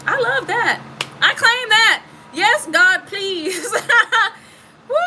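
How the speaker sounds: high, joyful and rising; thrilled, claiming the blessing; excited. Real, not acted. Delighted by the reading.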